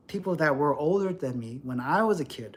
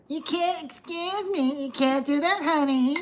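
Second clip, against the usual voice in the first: much higher, condescending honey; mocking gym women; 9 semitones higher than usual. [name] imitating gym women who scold him, high and patronising. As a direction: patronise like a smug gym worker.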